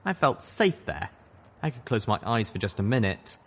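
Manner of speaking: plain reading voice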